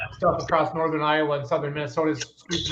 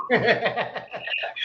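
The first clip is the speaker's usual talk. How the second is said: laugh; 6 semitones higher than usual. Real, not acted. Laughs at Keith's remark.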